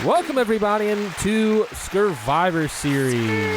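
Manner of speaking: slow, showy announcer welcome; podcast host intro. Acted. Husband welcoming listeners to the podcast, showman announcer. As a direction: open the show like a host, drawn out.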